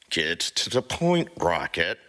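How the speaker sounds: impatient snarl through teeth. Acted. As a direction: growl it through clenched teeth. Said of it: "Peter snarls through his teeth".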